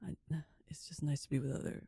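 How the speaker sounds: ordinary talk, nothing marked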